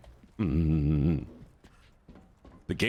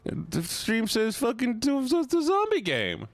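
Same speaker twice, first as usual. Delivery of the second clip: high drawn-out whine; 10 semitones higher, slower than usual. Real, not acted. Exasperated drawn-out complaint.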